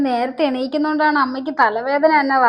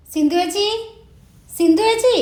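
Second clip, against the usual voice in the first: high call to a neighbour, rising higher on the repeat; 7 semitones higher than usual. Acted. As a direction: call her name urgently, more insistent the second time. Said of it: "അലറി വിളിക്കണത്". (ഉറക്കെ നീട്ടി വിളിക്കുന്നു)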